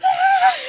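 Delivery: loud, very high excited squeal; shrill fangirl scream reenacted; losing-her-mind scream. Screaming with her friend over the bus, excited scream. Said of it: "losing my mind".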